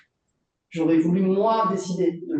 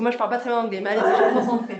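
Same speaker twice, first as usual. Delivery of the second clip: laughing through the words, louder; amused, laughing as she braces for an English question; 4 semitones higher, 4 dB louder, much faster than usual. Real, not acted. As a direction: say it with a laugh, game but nervous. Laughs, agrees to hear English.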